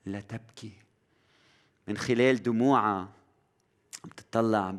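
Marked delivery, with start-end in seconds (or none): 0.1-0.7 "لا تبكي" breathed very softly and tenderly; gentle whisper. Acted. Jesus to the widowed mother, tender and very soft. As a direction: murmur "لا تبكي" like comfort to a grieving mother. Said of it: "صوت عم بيقول".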